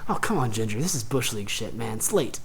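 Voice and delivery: quiet inner griping; internal monologue. Acted. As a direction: think it aloud, unimpressed, under your voice. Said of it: "internally is like".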